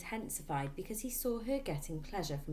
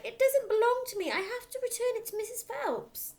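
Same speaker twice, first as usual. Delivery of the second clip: anxious protest; 8 dB louder than usual. A narrator's reading. Matilda protests to father.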